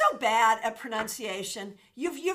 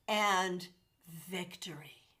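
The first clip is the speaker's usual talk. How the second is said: whisper; 6 semitones lower, 5 dB quieter, slower than usual. Acted. Calling in victory for viewers.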